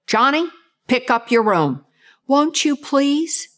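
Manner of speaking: parent's request ending in a rising tag; up talk to a child. Retold or imitated. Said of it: "Would you say to your children".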